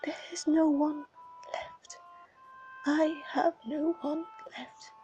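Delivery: broken, breathy begging in tears; tearful pleading whisper. Acted. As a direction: plead with the dead in a crying whisper. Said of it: "he sobs and sobs".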